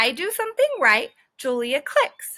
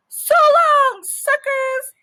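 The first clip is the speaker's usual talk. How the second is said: high, loud parting shout; dog yelling goodbye to classmates; gleeful yell; 13 semitones higher, 6 dB louder, slower than usual. Acted. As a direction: yell it out to rub it in. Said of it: "I yelled".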